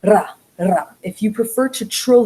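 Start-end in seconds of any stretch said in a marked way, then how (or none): 0.0-0.9 "Ra, ra" trilled on the tongue tip; front trilled r. Shown as a demonstration. Teacher showing the front rolled r, as in singing. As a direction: trill the r at the front against the teeth. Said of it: "roll the R in the front".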